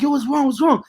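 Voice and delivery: high, urgent what's wrong; imitating himself asking his girlfriend, alarmed; worried asking. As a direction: voice your alarmed self asking. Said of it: "And I'm like".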